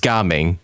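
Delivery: Gaming said GAH-ming, with an open "ah"; intended pronunciation. Shown as a demonstration. Host correcting himself on the character's name, Gaming pronounced GAH-ming. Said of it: "supposed to be pronounced Gaming".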